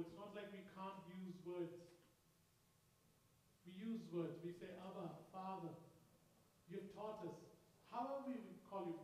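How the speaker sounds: quiet earnest prayer, gently repeating; soft prayer. Real, not acted. Pastor praying aloud with the church, soft and earnest. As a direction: keep calling Father softly and sincerely.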